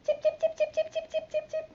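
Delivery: high chirping bird calls, very high; high bird chirps. Shown as a demonstration. Teacher answering the kids how a bird sounds, high chirps. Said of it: "How does a red bird sound?".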